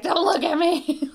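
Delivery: high, loud, slow retold yelp; mock distressed cry. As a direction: imitate yourself crying out in embarrassment. Retelling own embarrassing moment.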